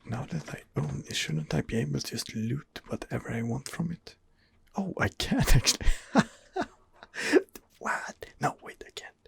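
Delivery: normal talking voice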